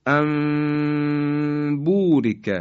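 انبوریک chanted very slowly, amburik; Quran recitation. Shown as a demonstration. Teacher reciting the word slowly. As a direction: draw out انبوریک with nasal mim. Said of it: "نون ساکن به حرف با رسیده".

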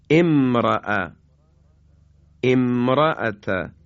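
امرأة started with a kasra as emra'a, slow; slow emphatic emra'ata; Quranic recitation. Shown as a demonstration. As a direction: begin امرأة with an e-sound, drawn out.

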